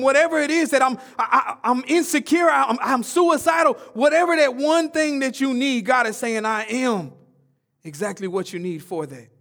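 loud, high, building sermon cry; full-force preacher climax; preaching at full force. Acted. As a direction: preach it out with rising passion. Preaching crescendo.

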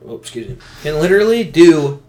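ordinary delivery

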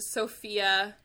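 ordinary talk

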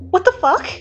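high-pitched shout of shock. A narrator's reading. Friend reacts to an outrageous confession, shouting. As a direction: exclaim it high, in astonishment. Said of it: "Mina shouts, laughing loudly".

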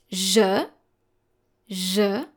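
"je" as the French soft zh sound, a short vowel after it. Shown as a demonstration. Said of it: "like the letter J in French".

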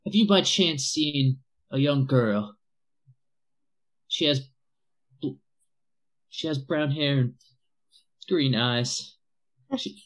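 lightly voiced anxious father searching for his girl; worried father. A father asks strangers about his lost daughter, mildly anxious. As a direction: ask haltingly, a hint of a frantic parent.

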